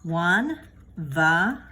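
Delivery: each word slowly spaced out; slow model sentence. Shown as a demonstration. Teacher modelling a Spanish sentence slowly for students. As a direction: model Juan va a comprárselas slowly, stress on "prár". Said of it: "let me try it that way".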